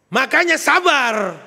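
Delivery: loud, slow, snapping "Makanya sabar"; harsh wrong intonation. Shown as a demonstration. Husband snapping at his wife at home, loud and harsh. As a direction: bark "sabar" like a scolding husband. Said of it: "intonasinya yang salah".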